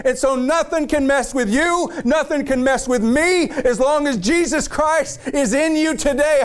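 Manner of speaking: high, impassioned preacher's climb; fired-up preaching to the congregation. Real, not acted. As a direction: preach it at full force, pitch rising. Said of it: "I'm sorry I'm fired up".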